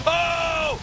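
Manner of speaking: sudden high excited "Oh!"; excited shout. Real, not acted. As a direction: yell "Oh!" at the big play. Caster reacts to a big fight.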